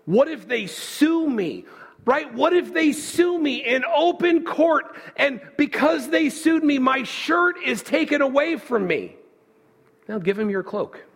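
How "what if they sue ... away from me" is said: high, rising mock complaint; mock-indignant what-if, high and slow; whiny protest. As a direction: play the indignant objector, pitched up.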